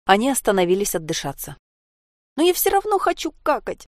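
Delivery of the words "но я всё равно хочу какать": put-on very high-pitched child's squeal; squeaky whine. A narrator's reading. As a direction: squeak it out like a pleading little girl. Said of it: "запищала снова Анька". (жалобно пропищать)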